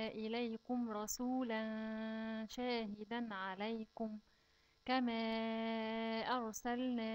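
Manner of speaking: slow, measured tajweed chant; measured recitation of the verse; slow Quran recitation. Shown as a demonstration. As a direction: recite it carefully for the children. Recites verse before explaining.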